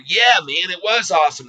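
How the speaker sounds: hammed-up delight; mock gloating. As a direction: crow about it like a smug insider. Imagined shorter answering, gloating.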